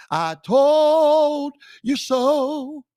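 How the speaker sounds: high, loud, slow sing-song I told you so; drawn-out victory taunt; gloating taunt. Real, not acted. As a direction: drag it out and rub it in. Gloating over being proven right.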